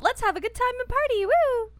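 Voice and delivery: upbeat party voice. Retold or imitated. Host mimics the cheerful music she wants, excitedly. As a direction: sell it like a fun party. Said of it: "I need something happier, something upbeat".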